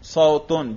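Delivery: صوتن ending in -un, sautun; صوتن with the raf -un ending; nominative ending. Shown as a demonstration. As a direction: say صوتن as "sau-tun", with a u before the n. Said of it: "رفع کا تلفظ کرو".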